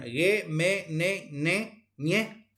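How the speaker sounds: each of "ge, me, ne, ne, ñe" sounded through the nose with an E; full nasal consonants. Shown as a demonstration. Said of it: "Cuatro son consonantes nasales plenas".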